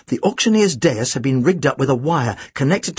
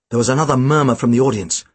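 plain reading voice

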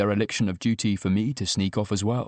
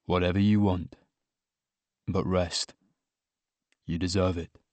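tender reassurance; 5 semitones lower than usual. A narrator's reading. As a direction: soothe a sleepy partner, low and gentle. Partner soothes sleepy narrator in bed.

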